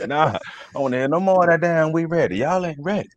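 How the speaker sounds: high-pitched imitation of a dismissive football coach; Coach Sampson. Former player imitating coach refusing the chant, mock scolding. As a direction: shut the team down in a mock coach voice. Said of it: "Who's that, Coach Sampson?".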